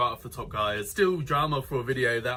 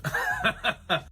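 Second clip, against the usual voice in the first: high giggle. Real, not acted. Laughing at own joke.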